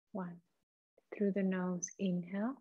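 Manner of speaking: soft, hushed meditation voice with long pauses; guided meditation. Acted. Leads a guided breathing meditation.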